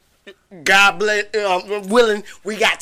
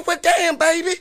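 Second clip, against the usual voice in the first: lightly voiced high Southern granny; a hint of a doting old Southern lady; sweet Southern grandma; 7 semitones higher than usual. Grandma softening to her grandson, lightly voiced high Southern granny. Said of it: "this Southern folk".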